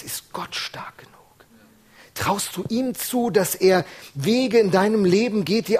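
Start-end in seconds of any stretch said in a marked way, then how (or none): 0.0-1.1 whispered pointed question. Acted. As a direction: lean in and whisper it.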